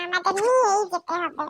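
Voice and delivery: normal talking voice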